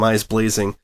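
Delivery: plain reading voice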